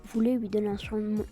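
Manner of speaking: usual speaking voice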